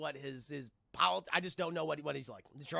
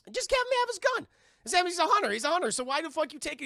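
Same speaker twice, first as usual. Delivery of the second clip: exasperated rant; 11 semitones higher, 6 dB louder, faster than usual. Real, not acted. Ranting at the movie makers.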